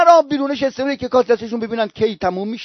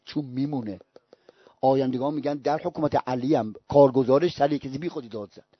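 low, hushed voice of the Imam; quiet reproof; 13 semitones lower, 13 dB quieter than usual. Retold or imitated. Imam reproving his appointee for shouting at someone, hushed and low. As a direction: rebuke softly and gravely, well below preaching level.

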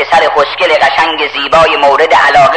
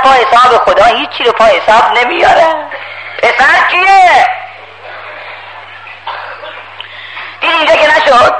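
lightly voiced scheming devil, higher; sly devil voice; 9 semitones higher than usual. Satan schemes, voiced high.

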